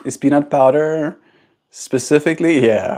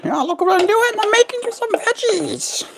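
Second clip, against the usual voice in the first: very high singsong pet voice; 3 dB quieter than usual. Real, not acted. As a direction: chirp it way up to the bird. Talking to bird while cooking.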